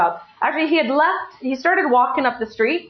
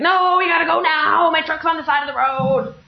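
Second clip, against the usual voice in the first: livid husband imitation; 5 semitones higher than usual. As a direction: imitate an angry man wailing his demand. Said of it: "he's livid".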